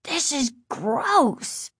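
put-on grossed-out boy; disgusted. A narrator's reading. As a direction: draw it out in disgust.

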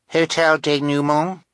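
put-on high, questioning little-girl voice; high-pitched toddler asking; small child's voice. A narrator's reading. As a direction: guess like a tiny child. Narrator voices baby Sunny guessing.

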